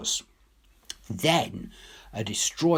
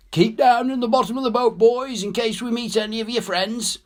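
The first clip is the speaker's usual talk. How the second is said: much higher, louder and fast shout; 11 semitones higher, 5 dB louder than usual. Acted. Boatman warns the soldiers to keep down, fast loud shout. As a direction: yell the warning over the gunfire.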